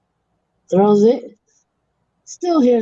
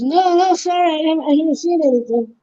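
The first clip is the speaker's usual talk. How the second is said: higher, flustered fib; nervous denial; 7 semitones higher than usual. Acted. Guy hiding the goat's fate from its owner, flustered denial. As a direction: cover it up fast, guiltily.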